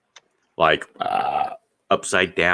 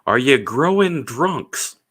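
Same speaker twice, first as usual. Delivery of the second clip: mock outraged wife; 7 semitones higher, 5 dB louder, slower than usual. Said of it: "she's like".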